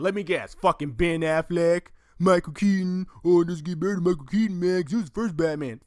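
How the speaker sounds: loud, high sneering mockery of other fans; sarcastic jeer at rival fan favourites; mocking fans. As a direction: rip into the Keaton fans with scorn. Mocking other fans' picks.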